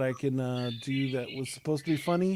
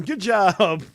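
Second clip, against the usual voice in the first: "Good job" said through a real laugh, louder; 7 semitones higher, 6 dB louder than usual. Real, not acted. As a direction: laugh out the words. Laughing after joking about winning.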